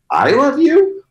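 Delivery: a high, loud, playful falsetto version; high falsetto take. Shown as a demonstration. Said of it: "or I could say".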